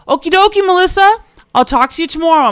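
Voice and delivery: high and loud mock sign-off; hammed-up cheery okie dokie, Melissa; imitating the CEO's okie dokie. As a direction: CEO mimics her cheery goodbye, high and loud. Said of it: "he turned to me, goes".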